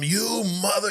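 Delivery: high-pitched imitation of an outburst; mock enraged cry; losing it. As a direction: act out a furious insult, voice shooting up. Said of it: "And he loses it".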